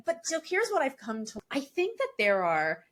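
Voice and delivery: normal talking voice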